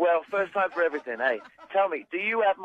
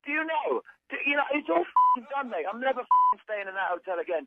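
angry rant; 6 semitones higher than usual. Acted. Guest rants at the hotel.

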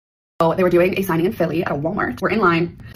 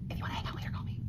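breathy whispered invitation across the table; secret whisper; 18 dB quieter, faster than usual. Retold or imitated. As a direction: whisper it so only he hears. Slipping number across the table.

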